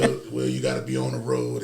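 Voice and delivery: put-on low old man voice, laughing through; old-timer lecturing with a chuckle. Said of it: "I'm doing the old man voice right now".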